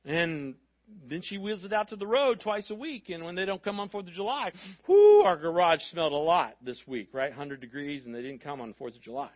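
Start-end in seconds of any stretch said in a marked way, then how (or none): 4.9-5.2 loud whoo at a stink; mock-overwhelmed whoo; exclaimed whoo. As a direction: blow out a big whoo over the smell. Reacting to smelly garage.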